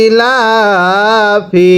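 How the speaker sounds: ইলাফি held long and very slow; teacher drawing out ইলাফি; slow drawn-out reading. Shown as a demonstration. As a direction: model the word slowly for pupils. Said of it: "আবার পড়তে হবে".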